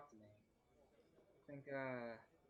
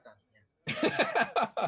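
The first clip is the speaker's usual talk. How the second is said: laughing; 16 dB louder, much slower than usual. Real, not acted. Joking about the dungeon's name.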